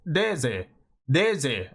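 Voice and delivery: DEZE with a tapped initial sound, close to a D, "deh-zeh"; Japanese-style Deze; Japanese pronunciation. Shown as a demonstration. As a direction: say Deze the Japanese way, pure short vowels. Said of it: "You know what it is in Japanese?".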